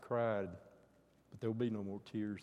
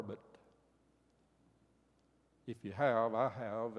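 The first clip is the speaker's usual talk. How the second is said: ordinary delivery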